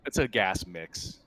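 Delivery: normal talking voice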